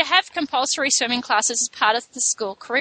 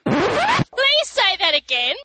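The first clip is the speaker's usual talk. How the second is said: delighted, drawn-out plea; 9 semitones higher, much slower than usual. Real, not acted. Emphatic delighted request.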